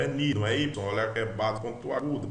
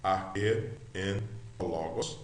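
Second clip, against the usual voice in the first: slow, softer word-by-word recitation; slow read-along; 4 semitones lower, 7 dB quieter, slower than usual. Shown as a demonstration. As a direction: repeat it slowly and quietly for learners. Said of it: "vamos repetir de novo".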